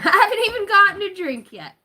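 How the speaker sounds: high, loud, laughing through the punchline. Real, not acted. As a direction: crack up as you repeat it.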